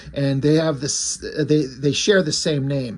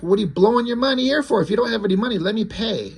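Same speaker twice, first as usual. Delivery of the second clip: higher-pitched, quick retold scolding; insistent protest; 7 semitones higher, faster than usual. Harry pushes broke David to let him pay, insistent. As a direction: act out an exasperated offer to pay.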